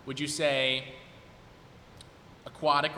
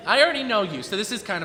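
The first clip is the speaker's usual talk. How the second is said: excited; 7 semitones higher, 8 dB louder than usual. Real, not acted. Host recognizes the contestant.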